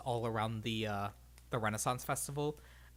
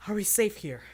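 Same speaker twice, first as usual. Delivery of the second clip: loud, higher breathless question; out of breath after the run, anxious question; panting; 7 semitones higher, 8 dB louder than usual. A narrator's reading. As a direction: ask between gasps for breath. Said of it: "panting and looking about".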